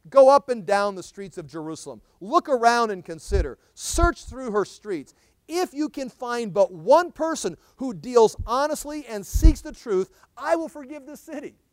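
loud, slow scripture proclamation; fiery preaching. Acted. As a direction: thunder the prophet's lament at them. Preaching scripture at full force.

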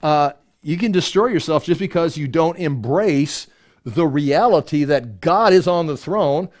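ordinary delivery

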